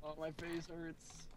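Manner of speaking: exhausted from laughing. Real, not acted. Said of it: "my face hurts so much from just laughing".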